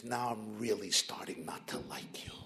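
mock grumble, whispered under the breath; a whispered mock complaint; annoyed whisper. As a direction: whisper it like a half-joking threat. Annoyed Israeli voiced in a joke.

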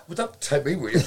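normal talking voice

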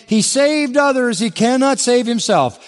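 mock him like a chief priest jeering; mocking sneer. Preacher voicing the chief priests' sneer, high and scornful. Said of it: "Were mocking him among themselves and saying".

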